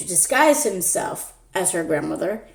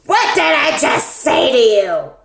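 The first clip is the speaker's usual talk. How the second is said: louder, higher outraged granny; indignant scolding; 5 semitones higher, 6 dB louder than usual. Acted. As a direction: snap back at the child. Fake grandma scolds again.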